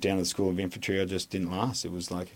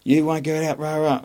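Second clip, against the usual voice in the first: 1 mock instructor yell; 8 semitones higher, 4 dB louder than usual. Mimicking instructor yelling back.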